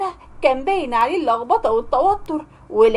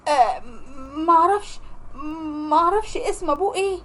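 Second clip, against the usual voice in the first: nervous stammer; 4 dB quieter than usual. Acted. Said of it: "اتوترت جدًا".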